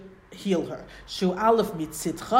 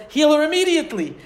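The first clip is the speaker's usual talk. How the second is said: higher and louder, pressing; urgent demand; 8 semitones higher, 9 dB louder than usual. Acted. Moshe demanding God heal his sister, urgent. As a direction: demand it now, with force.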